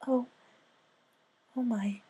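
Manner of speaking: high, soft, timid pony voice; high soft shy pony; Fluttershy voice. Acted. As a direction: speak like a shy gentle mare.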